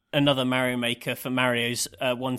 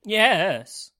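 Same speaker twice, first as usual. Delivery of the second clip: high, louder cartoon-hero cheer; a celebratory game-hero exclamation; Mario; 7 semitones higher, 5 dB louder than usual. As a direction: punch it out high like a game mascot. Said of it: "was that your Mario impression?".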